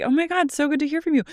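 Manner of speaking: high, fast gushing greeting; gushing receptionist. Retold or imitated. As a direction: gush over the caller. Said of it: "They're like".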